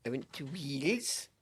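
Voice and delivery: put-on slow lilting Welsh accent. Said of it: "That's my attempt at a Welsh accent".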